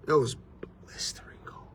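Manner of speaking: whispered for dramatic effect; barely-there whisper, letting it sink in; hushed whisper. Real, not acted. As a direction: drop to a bare whisper. Repeats the line for effect.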